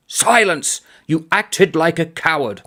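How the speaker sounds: a hint of a furious major cutting him off; barked order. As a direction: major silencing a cowardly gunner, mock bark. Major shouting again.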